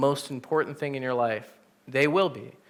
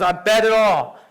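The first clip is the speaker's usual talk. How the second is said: higher and louder, a reckless gambler's boast; bold all-in bet; 7 semitones higher, 7 dB louder than usual. Retold or imitated. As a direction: shove all the chips in.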